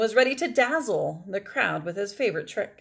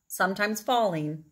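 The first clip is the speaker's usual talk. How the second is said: ordinary delivery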